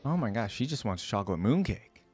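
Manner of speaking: ordinary talk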